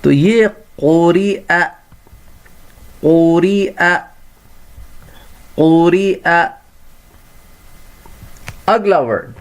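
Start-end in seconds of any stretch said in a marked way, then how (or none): 0.8-6.5 قریء ending on a glottal hamza, not a ya sound; slightly slow, clear hamza at the end. Shown as a demonstration. Teacher to pupils, showing the hamza sound. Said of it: "یا کی sound حمزہ کی sound سے بدل جائے گی".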